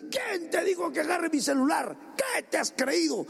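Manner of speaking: high, shouted outburst of a child in a mock tantrum; mock angry yell. Preacher voicing a child who yells when the phone is taken, mock fury. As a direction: play the teen snapping back over the phone.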